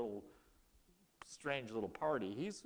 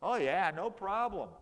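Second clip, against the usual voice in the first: higher and louder, brushing the worry off; lightly voiced, overconfident yes-man; hammed-up no problem; breezy mock reassurance; 5 semitones higher, 5 dB louder than usual. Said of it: "He goes".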